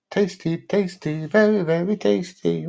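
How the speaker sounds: playful imitation of a food advert; advert jingle voice. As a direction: hit it like a TV ad tagline. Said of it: "What advert's that?".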